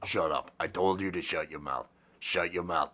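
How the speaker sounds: low, blunt pumpkin character; Frank. Acted. Pumpkin puppet telling someone to shut up, low gruff-talking character. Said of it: "That's a pumpkin talking, not me".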